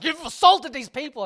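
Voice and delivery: high, loud mock accusation; outraged crowd. Host imitates everyone accusing the comedian, loud mock outrage. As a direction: cry it out high and loud, half laughing. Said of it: "everyone's like".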